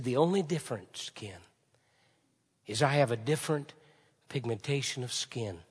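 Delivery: soft whisper of a friend's words. Retold or imitated. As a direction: breathe it, close and tender. Retelling friend's tender words.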